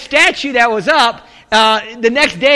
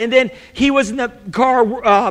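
ordinary talk

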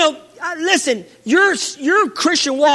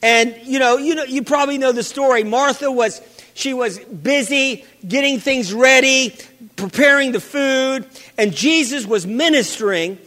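normal talking voice

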